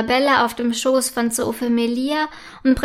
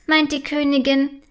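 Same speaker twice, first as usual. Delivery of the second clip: usual speaking voice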